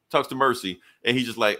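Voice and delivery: ordinary talk, nothing marked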